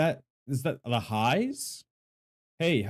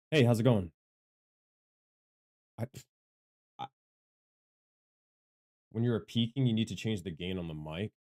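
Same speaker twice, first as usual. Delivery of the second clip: normal talking voice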